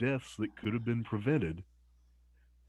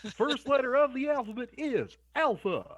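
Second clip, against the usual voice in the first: high barking drill sergeant voice; drill instructor; 4 dB louder than usual. Pretend. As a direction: bark orders at the cadets. Drill instructor teaching alphabet.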